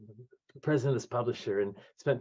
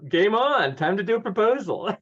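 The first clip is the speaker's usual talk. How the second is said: higher, louder gleeful resolve; fired up; 9 semitones higher, 4 dB louder than usual. Retold or imitated. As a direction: declare it like accepting a dare. Retold vow to take challenge.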